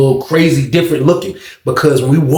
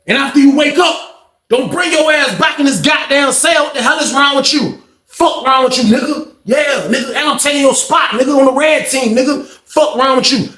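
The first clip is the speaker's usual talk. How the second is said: taunting tough guy; 10 semitones higher than usual. Retold or imitated. Sam taunting his snitch cellmate, mocking. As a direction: gloat over the knocked-out cellmate.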